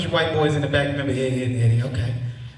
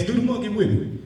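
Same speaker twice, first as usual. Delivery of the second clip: cartoon voice; 6 semitones higher than usual. Imitating cartoon character's little dance song.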